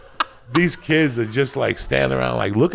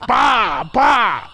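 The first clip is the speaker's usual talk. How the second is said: loud higher "bah, bah" smacking sounds; mock punch noises; 9 semitones higher, 5 dB louder than usual. Comedian acting out a kid's punches for the host, loud shouted punch noises.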